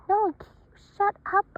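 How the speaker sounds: bossy little voice; high snappy retort. Acted. Raby Baby snaps at Raby Ray, high.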